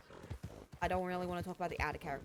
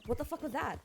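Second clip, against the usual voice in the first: higher-pitched retold outrage; mock indignant demand; 7 semitones higher than usual. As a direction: act out someone taken aback. Jin confronts Isabella after her joke.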